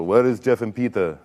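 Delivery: imitated Austrian accent; casual question in an Austrian accent; Arnold Schwarzenegger. Star asking after his makeup guys, Austrian accent. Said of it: "in his mouth and he goes".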